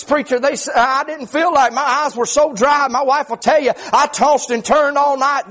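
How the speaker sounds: driving, rhythmic preacher's testimony; sustained high preaching voice, phrase after phrase; full-force preaching. Acted. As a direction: pour it out in urgent revival cadence.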